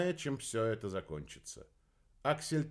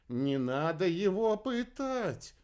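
high, slow character voice; slow, high-pitched gentle objection; Galileo's voice; 8 semitones higher, 3 dB louder, slower than usual. A narrator's reading. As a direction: interject high and drawn out.

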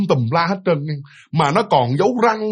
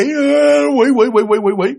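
high, raised cry of alarm; mock panicked outcry, higher pitched; retold terrified yelling; 7 semitones higher, 4 dB louder, slower than usual. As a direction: imitate someone yelling at a demon, words tumbling repeatedly. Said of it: "mẹ la lên như vậy".

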